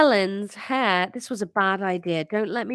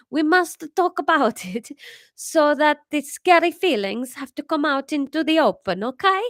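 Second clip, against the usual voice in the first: put-on French accent; 6 semitones higher, 4 dB louder than usual. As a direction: reassure in a French accent with a laugh. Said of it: "French accent".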